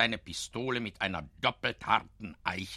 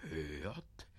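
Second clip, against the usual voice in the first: slow, much softer, fading to a whisper; hushed suspense; 5 semitones lower, 12 dB quieter, slower than usual. A narrator's reading. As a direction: draw listeners in to the bear's steps. Narrator builds suspense.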